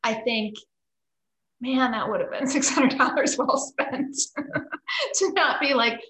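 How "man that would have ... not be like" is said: laughing. Real, not acted. Laughing while recalling costly choice.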